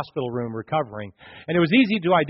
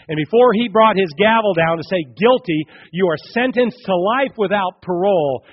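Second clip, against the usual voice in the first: loud, higher pulpit cry; preaching at full force; 5 semitones higher, 7 dB louder than usual. Acted. Preacher voicing the judge's verdict, full force. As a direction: pronounce the sentence from the pulpit.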